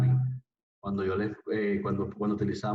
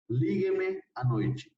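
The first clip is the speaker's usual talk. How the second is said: "lígueme" raised high and drawn out slowly; slow teacher demo; 8 semitones higher, much slower than usual. Shown as a demonstration. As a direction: model "lígueme à noite" high and slow for the class. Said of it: "Yo puedo decir". (demostración lenta y aguda de "lígueme")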